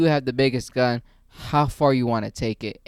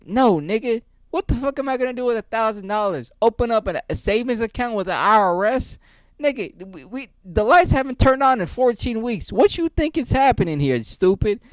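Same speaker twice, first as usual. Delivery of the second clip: higher, indignant scorn; mock angry scoff; 7 semitones higher than usual. Armed homeowner mocking the father's cash offer, higher and scornful. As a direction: sneer at the offer like it's a joke.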